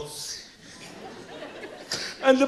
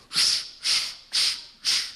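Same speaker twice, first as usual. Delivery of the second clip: whispered shh shh of chalk on a board; mock chalk scratching; chalk squeak noise; 4 dB quieter, slower than usual. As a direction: hiss out the sound of drawing on a blackboard. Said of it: "he kinda went".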